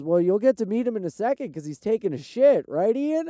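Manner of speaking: loudly ribbing his buddy through the door; shouting a tease toward the stall; friend calling out. Retold or imitated. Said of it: "and he goes".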